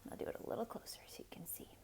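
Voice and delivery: unvoiced whispered aside. Real, not acted. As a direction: breathe it out softly as an aside. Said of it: "aside while showing the book".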